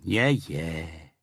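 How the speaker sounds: usual speaking voice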